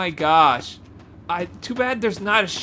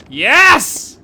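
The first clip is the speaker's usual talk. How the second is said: loud, higher cheer of victory; triumphant yes; 7 semitones higher, 12 dB louder than usual. Real, not acted. Gamer cheering a breakthrough to viewers, loud triumphant yes. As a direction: punch it out in triumph.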